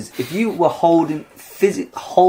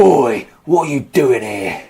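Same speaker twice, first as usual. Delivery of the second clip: slow, gruff London hardman in a put-on voice; Ray Winstone voice; slower than usual. Atlas confronting Perseus, a slow put-on London hardman. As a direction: menace the stranger like a London heavy. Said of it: "You sound like Ray Winston".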